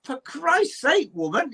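put-on scolding the girl for staying in bed, slow and exasperated; exasperated retort. Slow mock exasperation. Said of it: "And I went".

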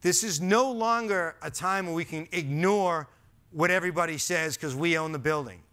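usual speaking voice

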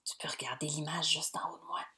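a quick hushed hint to the class; whispered hint. Acted.

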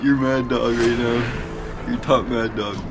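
normal talking voice

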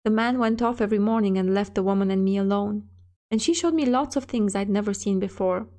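ordinary delivery, nothing marked

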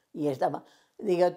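normal talking voice

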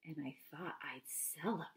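hushed whisper on the final line; whispered punchline. Acted. Teacher ending the ogre poem for the kids, in a whisper. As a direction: drop to a secret whisper for the ending.

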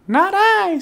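very high tiny mouse squeak; squeaky mouse. A narrator's reading. The mouse refusing the hen, high squeaky voice. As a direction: make it a little mouse's squeak. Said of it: "said the mouse".

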